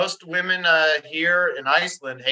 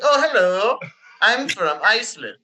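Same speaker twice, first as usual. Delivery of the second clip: higher-pitched, hammed-up fake Icelandic accent; 4 semitones higher than usual. Shown as a demonstration. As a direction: badly fake being a local from Iceland, accent and all. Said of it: "what accent did you try to do".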